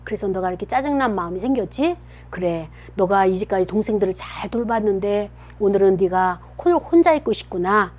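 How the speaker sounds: put-on a parent softly comforting a grumpy child; soothing parent. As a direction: soften right down, like a parent reading a child's heart. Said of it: "엄마, 아빠가 옆에서 여러분의 마음을 읽어줘야".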